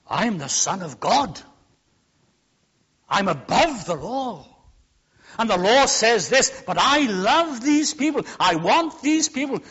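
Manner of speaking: loud, declaring as Christ; emphatic preaching. Acted. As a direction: proclaim it from the pulpit. Preacher voices Christ defying law.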